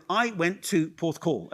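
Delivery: "I went to Porthcawl" said slowly, each word set apart for the players; slow model line. Shown as a demonstration. Coach slowly models "I went to Porthcawl" for the nervous players. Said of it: "So, so start with".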